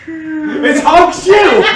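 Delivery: high, slow, laughing shout. Real, not acted. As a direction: yell it through laughter. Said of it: "How do you fake snore?".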